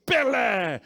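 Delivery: loud, high yell of a name; excited shout. Retold or imitated. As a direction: shout it like a thrilled fan. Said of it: "ele gritou assim". (grito alto de torcedor)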